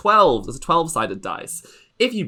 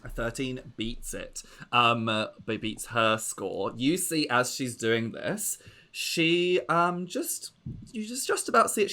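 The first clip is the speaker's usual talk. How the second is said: ordinary talk, nothing marked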